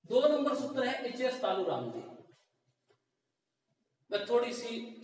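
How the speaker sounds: ordinary delivery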